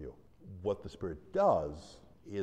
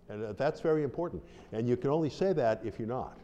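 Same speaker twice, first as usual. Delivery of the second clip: normal talking voice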